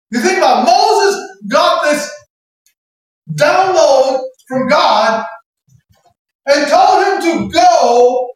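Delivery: slow, emphatic high preacher's delivery; full-force preaching. Acted. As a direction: drive each phrase home, stretched out.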